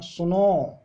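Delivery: सुनो called out with the ओ stretched long; drawn-out call. Shown as a demonstration. Teacher showing how calling out सुनो stretches the vowel. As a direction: call सुनो, holding the final ओ. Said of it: "सुनो में हमने लंबा time तक खींचा है".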